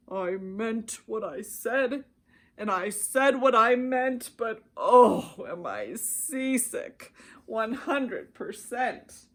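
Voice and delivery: slow, lightly voiced seasick elephant; Horton, seasick. A narrator's reading. Horton groaning at sea while still keeping his word. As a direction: drag it out, queasy but steadfast. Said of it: "Horton said day after day".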